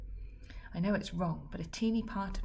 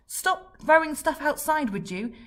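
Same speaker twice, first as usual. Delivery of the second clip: higher, louder, slowed plea; exasperated shout; 10 semitones higher, 10 dB louder, slower than usual. Acted. Fred shouting at Dad to stop throwing things. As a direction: shout him out of it. Grabbing the crutch.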